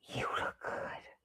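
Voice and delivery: slow breathy whisper; whispered compliment. Retold or imitated. Friend calling to say she looks good, slow whisper. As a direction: hush it, almost conspiratorial.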